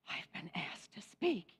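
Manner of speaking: slow, hushed, amazed; awed whisper-like delight. Retold or imitated. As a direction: say it softly, stunned with joy. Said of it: "that little bit of".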